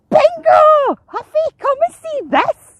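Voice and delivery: high, loud seagull shout; calling over. A narrator's reading. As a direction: shriek excitedly to a friend across the way. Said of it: "Screch called over".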